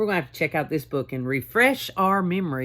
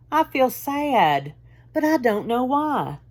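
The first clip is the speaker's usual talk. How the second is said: put-on a little boy sighing out his sadness; sad sighing child; 6 semitones higher than usual. A narrator's reading. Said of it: "with a great big sigh".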